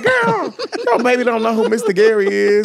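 squealed mock disbelief; incredulous sass. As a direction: tease the parent, laughing. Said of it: "I'm like".

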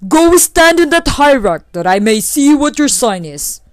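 loud, high-pitched order; commanding lightning. Acted. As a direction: command like a mighty lightning spirit. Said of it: "commanded the lightning".

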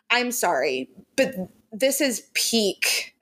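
ordinary talk

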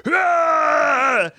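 high imitated monster roar; troll roar. Troll blasts back at Ernest, high mock roar. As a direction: let out a long, high mock creature scream. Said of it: "the troll just goes".

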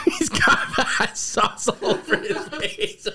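breathy laughter through words; can barely talk for laughing. Real, not acted. Laughing at sauce-covered face.

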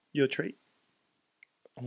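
ordinary delivery

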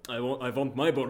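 a hammy vampire count; Dracula voice. Retold or imitated. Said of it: "Now you turned into Dracula".

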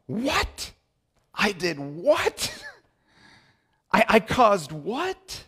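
high, drawn-out shocked "what?"; alarmed disbelief. Acted. Pastor acting a sinner's alarmed realisation, stunned disbelief. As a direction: react as if just caught out, stunned.

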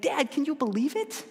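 put-on high, thrilled child voice; a kid amazed at the home run; excited kid. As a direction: kid to his dad at the ballgame, high awed voice. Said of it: "they're just like".

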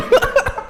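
high laugh. Real, not acted. Burst of laughter.

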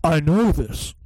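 game character voice. Retold or imitated. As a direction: ham up the flat hero line. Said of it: "In fact, no, no, I went".

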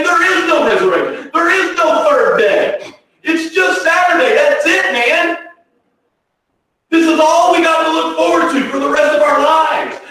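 high-pitched, insistent hopeless outcry; voiced despair. Preacher voices early doubters of a third day, despairing. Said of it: "They live in despair".

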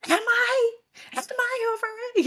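squeaky, high, upset "am I?"; high whiny protest. Retold or imitated. Wife squeaking back at her husband, high and whiny. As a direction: wail it high and fast like a wounded child. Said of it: "And I was just like".

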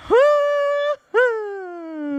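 high wordless announcer howl; long mock wail falling away; exaggerated ring-announcer noise, way up in pitch; Dave Penzer. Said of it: "Dave Penzer, everything he says is just like".

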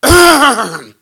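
a big booming throat clear; loud throat clearing. Shown as a demonstration. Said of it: "doesn't sound like this".

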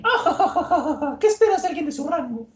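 high cackling laugh into a sneering question; mocking villainess laugh. Miss Valentine laughing at Igaram, high mocking cackle.

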